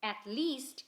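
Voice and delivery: ordinary delivery, nothing marked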